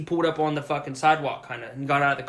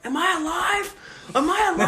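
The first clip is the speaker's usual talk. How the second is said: panicked. Retold or imitated. Dazed victim frantically asks the stranger if he's alive. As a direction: ask it in disbelief, frantic. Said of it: "and I'm like".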